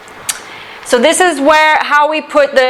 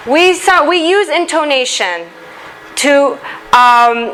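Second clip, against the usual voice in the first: ordinary talk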